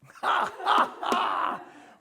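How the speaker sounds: loud, high-pitched burst of laughter. Real, not acted. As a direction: let out a big, high laugh. Reacts to participant's confession.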